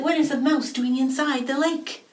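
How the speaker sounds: high astonished question; surprised. Retold or imitated. Fisherman pulling up a mouse, surprised. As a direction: sound amazed at the catch.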